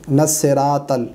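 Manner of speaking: light s and t in "नसिरातल"; thin Urdu-style consonants in "नसिरातल"; common mistake. Shown as a demonstration. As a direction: say "नसिरातल" as "nas-seratal". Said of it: "नसिरा नहीं पढ़ेंगे".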